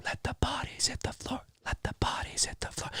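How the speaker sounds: hushed, voiceless chant; eerie whispered refrain. Acted. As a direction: whisper it like a creepy lyric. Whispered song lyric quote.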